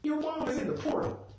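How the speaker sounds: high, squeaky mock explanation; exasperated. Viewer answering a character about the missing mom, exasperated.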